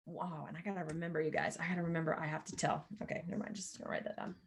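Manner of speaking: fading from soft mutter to near whisper; muttered aside. Real, not acted. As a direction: rush a private note-to-self under the breath. Muttering a reminder to herself.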